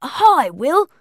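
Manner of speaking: loud, high, over-bright greeting; high, booming hello; said loudly. A narrator's reading. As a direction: greet too loudly to cover embarrassment. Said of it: "he said loudly".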